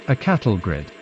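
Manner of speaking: ordinary delivery, nothing marked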